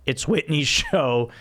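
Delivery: normal talking voice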